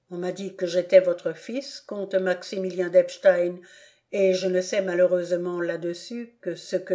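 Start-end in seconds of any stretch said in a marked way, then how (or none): none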